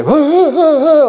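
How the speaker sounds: high, loud herding hoots; hooting call. Retold or imitated. Farmer on a motorbike hooting at sheep, high loud calls.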